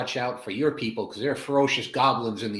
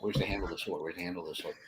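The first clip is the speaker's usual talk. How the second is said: much softer, low mutter while fumbling; 4 semitones lower, 9 dB quieter, much faster than usual. Acted. Farmer mutters while fumbling for his sword, quietly. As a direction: mutter to yourself searching.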